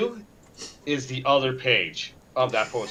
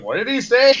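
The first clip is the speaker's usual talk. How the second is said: imitating dad; 8 semitones higher, 5 dB louder than usual. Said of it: "my dad's like".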